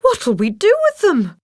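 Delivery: overwhelmed question. A narrator's reading. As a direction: wonder aloud at so many dogs. Anita overwhelmed.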